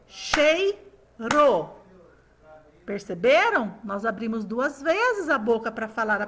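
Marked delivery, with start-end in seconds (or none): 0.1-1.7 CHEIRO in two beats, chei, ro; separate, deliberate CHEI and RO; syllable by syllable. Shown as a demonstration. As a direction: chant "Cheiro" with a pause after CHEI. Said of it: "batendo palma pra perceber".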